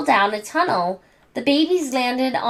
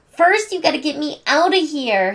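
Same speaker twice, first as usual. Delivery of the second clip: hissing; 6 semitones higher than usual. Acted. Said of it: "hissed Angelica".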